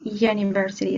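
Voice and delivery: "University" starting with a consonant Y, said softly; initial Y sound. Shown as a demonstration. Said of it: "like a Y".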